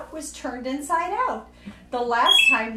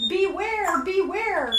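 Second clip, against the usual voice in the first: raised warning call; 5 semitones higher, slower than usual. A narrator's reading. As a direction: call out to warn the bear. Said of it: "called the bees to the bear".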